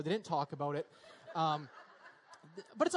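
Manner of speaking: words broken by a real chuckle; chuckling. Real, not acted. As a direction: laugh through the line at the memory. Laughing while telling parents' story.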